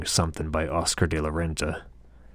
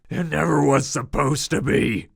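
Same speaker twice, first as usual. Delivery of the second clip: loud, higher bellow; 10 semitones higher, 5 dB louder than usual. A narrator's reading. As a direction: roar it back at him. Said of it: "I bellow".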